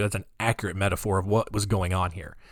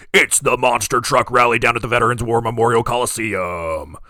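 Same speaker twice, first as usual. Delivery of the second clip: booming, louder hyped rally announcer; monster truck announcer; 6 dB louder than usual. Retold or imitated. Mocking radio ads for monster truck rallies, booming announcer voice. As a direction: sell the Sunday rally like an over-the-top radio ad. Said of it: "that monster truck voice guy".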